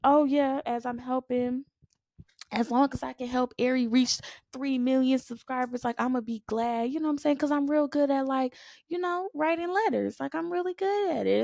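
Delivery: high, light mocking voice; mocking a self-limiting attitude; mock inner voice.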